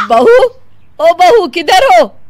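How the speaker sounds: loud, high call, slow across the house. Acted. Mother-in-law calling the bride, loud call. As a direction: call for her from another room.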